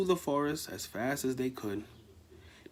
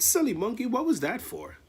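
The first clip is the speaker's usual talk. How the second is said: voiced higher, mock scolding; higher elephant voice; 10 semitones higher than usual. Ellie scolding the monkey who hit her, higher pitched. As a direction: squeak it out, a bit indignant.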